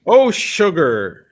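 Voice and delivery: mum's drawn-out mock exclaim; mother's exclamation. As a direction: drag out a mock-shocked "Oh, sugar". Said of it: "she'll say shukra".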